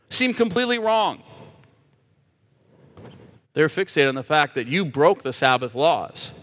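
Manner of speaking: ordinary talk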